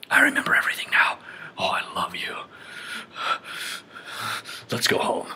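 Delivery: breathy whispered lovers' line; mock whisper. Mocking the movie couple, a whispered love confession. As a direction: whisper it like a cheesy romance.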